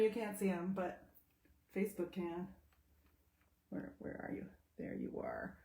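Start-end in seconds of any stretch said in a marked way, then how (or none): 3.7-5.5 whispering to find the cat; breathy whisper, searching. Real, not acted. Calling softly for her cat in a whisper.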